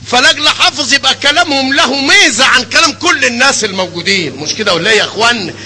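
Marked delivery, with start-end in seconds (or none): none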